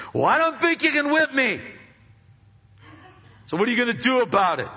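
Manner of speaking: loud, high defiant taunt; hostile challenge. Acted. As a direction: get in his face and dare him. Hostile man confronting, acted quote.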